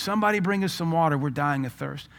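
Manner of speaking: high, quick pleading cries; imitated screams. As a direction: plead from afar in a half-screamed retelling. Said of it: "I can hear these screams".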